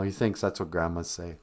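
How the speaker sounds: ordinary delivery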